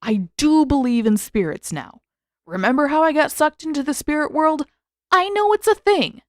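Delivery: a hint of indignant protest; defensive insistence. Sokka defending himself.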